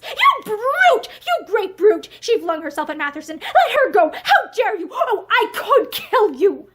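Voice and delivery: high, loud furious shouting. A narrator's reading. Sister attacking the husband, furious shout. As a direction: rage at him at full voice. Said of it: "with furious eyes".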